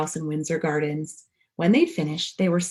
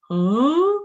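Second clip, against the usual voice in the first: high, loud puzzled huh; a startled what; confused huh; 7 semitones higher, 7 dB louder than usual. A narrator's reading. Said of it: "said the man".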